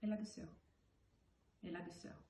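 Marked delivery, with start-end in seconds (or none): 1.6-2.2 soft echo. Acted. Poet repeating the last words of the poem, soft echo. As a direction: let the line fade into a quiet close.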